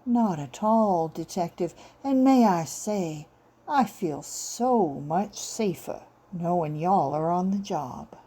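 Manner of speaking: slow, syrupy Southern belle with an icy edge; lightly voiced Southern woman, sweet but cold; southern honeyed drawl. Southern woman sweetly chills the detective, slow honeyed drawl. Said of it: "Her voice dripped Tupelo honey about three degrees off freezing".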